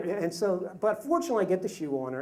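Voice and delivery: ordinary delivery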